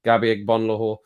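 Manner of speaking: normal talking voice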